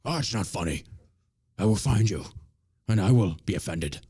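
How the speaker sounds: low, menacing mock action-hero threat turned whiny; a hint of a gravel-free movie threat, mocked; mock Liam Neeson. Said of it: "be a woke little bitch".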